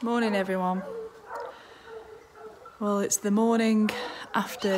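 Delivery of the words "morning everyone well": normal talking voice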